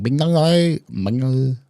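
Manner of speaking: bouncy, high put-on Vietnamese accent; sing-song mock Vietnamese accent. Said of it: "I could do an expert Vietnamese accent".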